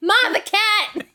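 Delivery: high, loud, drawn-out mock holler; mock yell to Ma. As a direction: playfully holler through the house for your mother. Joins the Jersey yelling-at-Ma bit.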